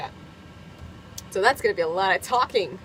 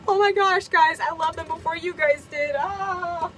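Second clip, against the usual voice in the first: much higher, gushing goat-fan boast; mock excited squeal; 7 semitones higher than usual. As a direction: squeal it like a proud superfan. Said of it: "I'm like".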